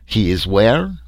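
put-on eager question in a French accent; eager French biologist. A narrator's reading. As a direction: ask keenly, French-accented. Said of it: "Leroy was eager".

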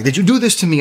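high-pitched panicked accusation; retold panic, pitched well up; freaking out. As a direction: act out a frantic demand for answers. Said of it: "I'm freaking out".

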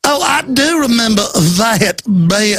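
put-on Southern Georgia gentleman accent, mocking; fake Georgia accent. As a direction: ham up a fake Georgia accent, sing-song and drawn out. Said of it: "This affected fake Georgia accent".

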